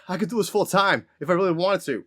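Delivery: lightly voiced Boston game character; Team Fortress Scout. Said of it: "like, Team Fortress".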